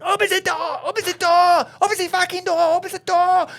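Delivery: put-on very high, loud German-accented scream; German man screaming. German passenger at the blocked exit, frantic German-accented screaming. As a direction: imitate a panicked German shrieking repeatedly. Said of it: "this German dude, and he's going".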